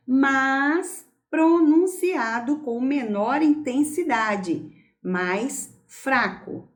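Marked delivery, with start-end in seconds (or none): none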